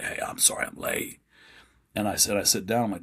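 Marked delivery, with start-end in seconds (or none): 0.0-1.2 low, soft, dried-out croak; parched croak. Retold or imitated. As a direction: imitate a voice gone from thirst. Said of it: "I'm talking like this".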